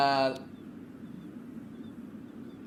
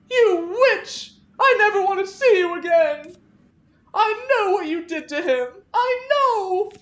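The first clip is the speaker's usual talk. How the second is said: much higher, much louder accusing scream with a cry; Clarissa screaming; 9 dB louder than usual. Acted. Grieving Clarissa accuses Veronica through the locked door, screaming. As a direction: hurl it at her like she murdered him.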